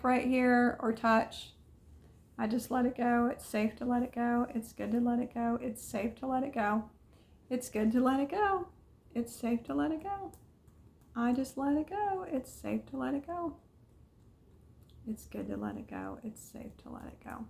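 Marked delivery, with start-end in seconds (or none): 2.4-13.5 quiet, lulling chant of let it go; soft repeated affirmation. Acted. Coach tapping along with viewers, quiet and soothing. 15.1-17.4 affirmations trailing off into a whisper; fading to a whisper. Acted. Coach finishing the tapping affirmations, whispered.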